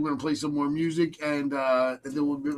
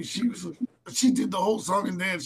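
ordinary talk, nothing marked

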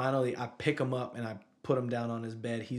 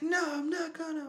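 high-pitched mock kid's yell; imitated child yelling. Dad imitates son refusing to go to his room, high mock yell. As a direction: squeal it out like a defiant little boy. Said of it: "He starts yelling".

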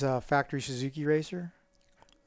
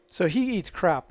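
ordinary talk

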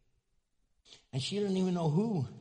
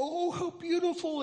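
mock gushing; 12 semitones higher than usual. Mock reaction to nice card.

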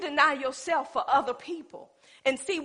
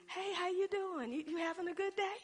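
butter up the person she owes; sugary phone greeting; 5 semitones higher, 9 dB quieter than usual. Retold or imitated. Acting sweet phone call.

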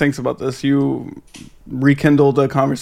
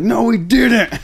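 higher-pitched mock denial; mock protest; 8 semitones higher, much slower than usual. Host quoting a producer denying a sample, mock protest. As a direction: imitate a producer's quick, indignant denial. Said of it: "The producer was like".

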